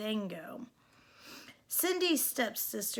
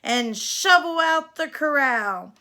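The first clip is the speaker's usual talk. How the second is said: stepmother yelling; 3 semitones higher, 10 dB louder than usual. Acted. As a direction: bark chores at her like a mean stepmother. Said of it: "she would yell".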